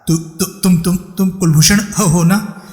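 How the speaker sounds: high, stammering and stumbling in fear; stammering terror. A narrator's reading. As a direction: choke out the name in panic. Terrified hostage recognizes his enemy.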